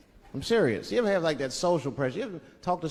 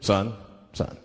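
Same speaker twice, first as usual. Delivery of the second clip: much lower, stern cop; 9 semitones lower than usual. Retold or imitated. Cop pulling a driver over, low and stern. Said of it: "the police pull me over".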